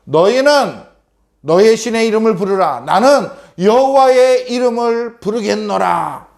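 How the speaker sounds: high, solemn prophetic proclamation; 선지자 엘리야. Acted. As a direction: proclaim it to the people, pitched high, slowing at the end. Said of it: "엘리야는요, 백성들에게 이야기했어요". (높은 목소리로 엄숙하게 선포하듯)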